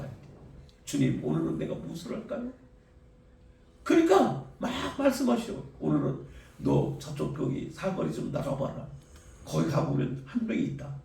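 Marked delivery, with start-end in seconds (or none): 0.8-2.5 soft humble prayer. Retold or imitated. Quiet prayer voiced in story. 5.8-10.9 voice of the Lord. Retold or imitated. God's voice in retold story.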